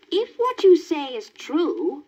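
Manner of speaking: high, slow witch voice. Acted. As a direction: weigh his words like a crafty witch. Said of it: "said the witch".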